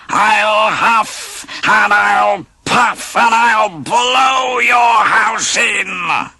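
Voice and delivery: high, slow, drawn-out furious threat; angry wolf shout. Acted. Angry wolf threatening the pigs, slow high shout. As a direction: shout each word at the brick house. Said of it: "The angry wolf shouted".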